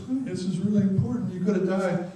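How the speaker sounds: high-pitched parent losing control; mock tearful pleading. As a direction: imitate an overwrought parent close to crying. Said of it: "is to cry, is to lose control".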